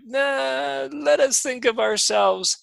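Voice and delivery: a hint of Italian accent as Pope Francis; half-done Italian accent, quickly dropped. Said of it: "I almost did an accent there".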